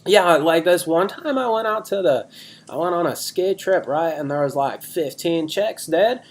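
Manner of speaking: bragging bro telling a wild story; Chaz. Acted. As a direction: stretch the key words like an excited party bro. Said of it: "we're doing characters this week".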